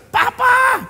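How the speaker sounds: put-on high, loud child's call of papa. Preacher voicing a child shouting papa. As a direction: pitch it way up like a small kid.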